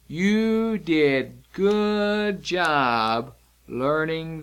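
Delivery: very slow, drawn-out words; slow signed praise. Shown as a demonstration. Teacher praising learners while signing along, slow and drawn out. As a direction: stretch each word, one at a time.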